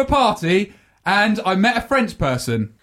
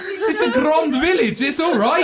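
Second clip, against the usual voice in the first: higher, drawn-out comic self-defence; mock-indignant protest; 8 semitones higher than usual. Real, not acted. Mock defence against being corrected.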